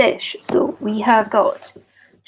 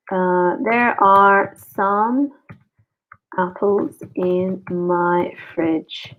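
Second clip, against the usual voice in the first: slow, deliberate teacher demo; unhurried classroom example; slow model sentence; slower than usual. Shown as a demonstration. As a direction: say it slowly for learners to copy. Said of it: "I can say it like this".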